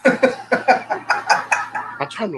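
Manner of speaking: high, very slow, strained squeak; squeaky strained voice. Acted. Streamer strains to talk through a high, slow squeak. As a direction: squeeze the words out high and slow.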